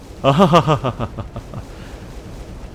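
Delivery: long, rolling put-on laugh; hearty character laugh. Shown as a demonstration. Streamer laughs in the knight's character, hearty and put-on. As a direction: laugh it out grandly, trailing away. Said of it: "I would read his voice more like".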